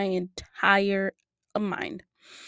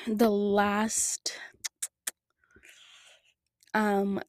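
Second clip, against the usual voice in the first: normal talking voice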